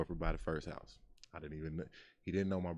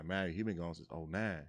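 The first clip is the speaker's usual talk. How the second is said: ordinary talk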